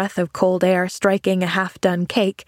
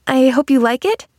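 "I hope you like it" higher, lifting at the end; rising inflection; 6 semitones higher, 3 dB louder than usual. A narrator's reading. Pollyanna offering the man jelly, hopeful rising inflection. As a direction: let "like it" rise hopefully. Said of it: "There was a rising inflection in her voice".

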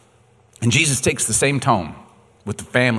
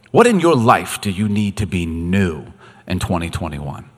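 normal talking voice

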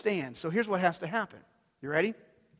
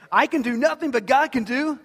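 "can" and "God" lifted higher and louder; louder refrain; 5 semitones higher, 5 dB louder than usual. Shown as a demonstration. Preacher raising the refrain with the congregation, louder and higher. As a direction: build the chant up, call it out higher. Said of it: "now you guys are catching on".

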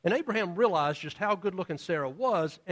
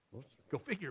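normal talking voice

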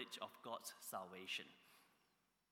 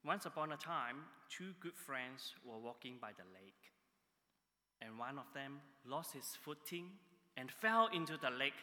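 ordinary talk, nothing marked

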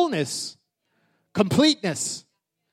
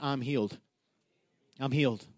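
calm, soft "I'm healed" said low and softer; quiet declaration; 5 semitones lower, 9 dB quieter than usual. Shown as a demonstration. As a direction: speak "I'm healed" softly, a quiet confession. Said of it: "just say that".

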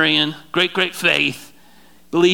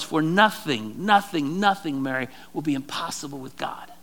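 hushed promise; 5 semitones lower, 7 dB quieter than usual. Acted. Angel's promise, hushed for emphasis.

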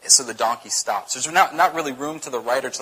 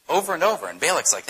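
normal talking voice

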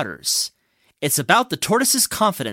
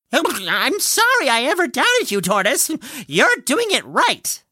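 high-pitched chipmunk calling through a full mouth; mouth-full chipmunk shout; 7 semitones higher than usual. A narrator's reading. Chipmunk apologises to Tortoise from alongside the racetrack, high mouth-full shouting. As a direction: shout it high, cheeks stuffed with acorns. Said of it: "a mouth full of acorns, shouting".

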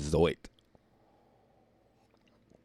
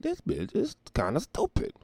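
incredulous inner voice; 12 semitones higher than usual. Retold or imitated. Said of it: "I'm like".

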